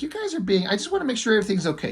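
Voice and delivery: nervous high voice. Acted. Bliss nervously checks on neighbors.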